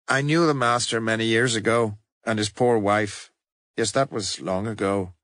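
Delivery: a hint of an elderly priest remembering; musing old priest. A narrator's reading. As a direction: muse fondly on the old days. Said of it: "mused the little priest".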